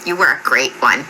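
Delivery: low, understated imitation of her praise; lightly imitated, pleased verdict; grinning reply. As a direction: relay her words low, with a slight grin. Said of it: "she kinda grinned a little bit".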